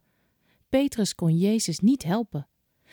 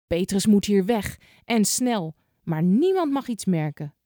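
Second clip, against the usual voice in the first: plain reading voice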